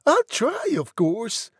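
put-on high falsetto young lady; obliging, very high girlish reply. A narrator's reading. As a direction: answer in a light falsetto.

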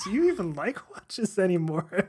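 high, loud laughing question. Real, not acted. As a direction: laugh while asking it. Laughing at listener emails.

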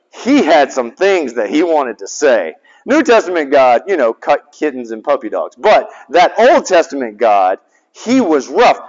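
usual speaking voice

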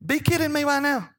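put-on high, far-off little boy voice saying "Be kidding me right now"; imitating grandson. Grandpa imitating his grandson's cheeky line, high child voice. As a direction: mimic a small grandson's cheeky retort. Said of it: "That's what he says to me".

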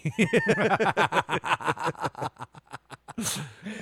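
long high-pitched laugh. Real, not acted. Podcaster cracking up at his own money joke, long high laugh.

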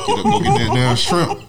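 laughing mock order. Joking with friend about the shrimp, laughing mock demand. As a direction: bark the order while cracking up.

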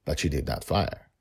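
ordinary delivery

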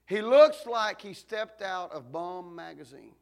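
slow, loud, punched preacher line; preaching at full force. Real, not acted. Preacher describing the ruined pastor, slow and loud. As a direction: hammer each word to the back pew.

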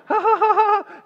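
high, loud mocking cackle; mock laughter. Gossiping group laughing at the clown, hammed-up.

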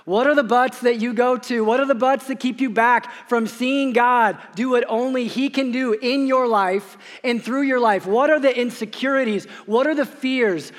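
rising pulpit fervor; preaching at full force. Acted. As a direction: press each question on the congregation. Preaching climax.

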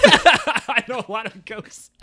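slow, laughing parrot of his words; mock his claim while cracking up; laughing mockery. Real, not acted. Echoing her cohost's boast back at him, laughing and mocking.

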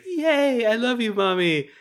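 put-on very high, gleeful child; mock little kid. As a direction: squeal like a happy little boy. Little kid voiced to mom.

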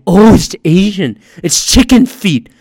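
overplayed fake enthusiasm; mock excitement. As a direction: exclaim it like a hammed-up reveal.

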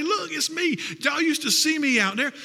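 high, thrilled calling out to the crowd; joyful blind man, high and bright; excited healed man. Retold or imitated. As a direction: show off your new sight with joy. Healed blind man shows himself off.

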